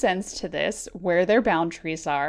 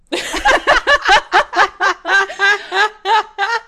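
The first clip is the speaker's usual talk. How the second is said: loud laughing fit; 13 semitones higher, 12 dB louder than usual. Real, not acted. Laughing fit at crab talk.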